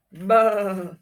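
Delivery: playful mock goat bleat. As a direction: bleat it like a billy goat for the kids. Teacher imitates a goat.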